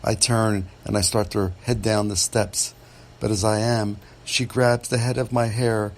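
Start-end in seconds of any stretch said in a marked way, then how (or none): none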